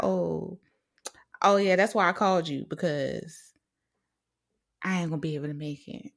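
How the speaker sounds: low, offhand flaky-friend voice. Retold or imitated. Imitating a friend cancelling plans, low and offhand. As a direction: drop the bail casually, low and quick. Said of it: "And you just like".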